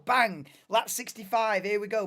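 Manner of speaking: punchy shouted "Bang!" as the race restarts; loud call. Real, not acted. Streamer calling the restart to viewers, loud punchy shout. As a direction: bark it out like a race caller.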